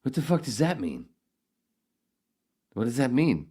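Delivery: outraged demand. Real, not acted. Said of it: "real outrage at accusation".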